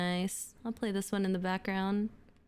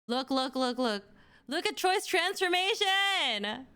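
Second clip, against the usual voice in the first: normal talking voice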